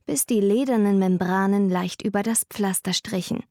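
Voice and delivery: ordinary delivery, nothing marked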